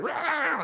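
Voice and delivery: high-pitched yowl of a dog; imitated dog snarl. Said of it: "she goes like".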